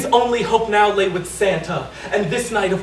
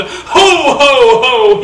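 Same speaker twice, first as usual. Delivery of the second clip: big, loud hearty ho ho ho; Santa bellow; 2 semitones higher, 9 dB louder, much slower than usual. Acted. Santa bounding down the chimney, loud bellowing laugh. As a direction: boom it out like Saint Nick. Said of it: "he bellowed".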